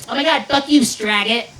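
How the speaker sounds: high-pitched indignant insult; mock road-rage outburst. Driver cut off in traffic, mock outraged cry. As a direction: throw it out as a comic road-rage yelp. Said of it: "they're gonna be like".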